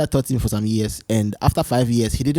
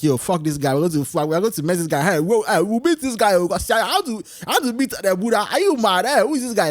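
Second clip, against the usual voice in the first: higher, pitched-up mock outrage; mock angry disciples; 5 semitones higher than usual. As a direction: play a riled-up gang of monks spoiling for a fight. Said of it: "But they were angry".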